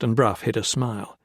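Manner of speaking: plain reading voice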